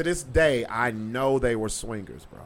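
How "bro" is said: usual speaking voice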